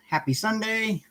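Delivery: bright lifted happy Sunday; lifted, beaming hello to the stream; cheery greeting. Real, not acted. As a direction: sing out the greeting to the viewers. Cheery greeting to live viewers.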